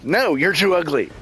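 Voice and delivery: high-pitched, emphatic comic refusal; mock rejection. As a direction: imitate the haunt actor turning you down. Imitating haunt actor's refusal.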